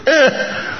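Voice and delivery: put-on short, very high baby squeak; comic high-pitched little cry; baby's cry. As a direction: mimic an infant's bleat for laughs. Imitating the baby's cry for laughs.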